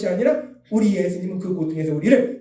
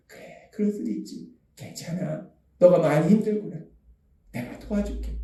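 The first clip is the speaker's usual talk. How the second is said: soft, near-whispered reassurance; Jesus comforting; 4 dB quieter than usual. Acted. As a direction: comfort someone struggling, very softly. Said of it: "예수님이 저한테 오셔서". (속삭이듯 부드럽게 위로하는 말투)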